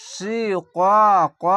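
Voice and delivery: শিকাক slowly, syllable by syllable, in full Quranic recitation; drawn-out recitation. Shown as a demonstration. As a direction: stretch শিকাক and chant it like tajweed. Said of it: "কফ যাবার ক". (শিকাক ধীরে টেনে তিলাওয়াতের সুরে)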